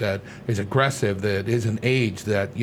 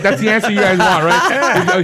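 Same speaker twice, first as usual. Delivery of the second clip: loud, high laughing; 9 semitones higher, 9 dB louder, slower than usual. Real, not acted. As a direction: laugh through the words. Joking about customers, laughing.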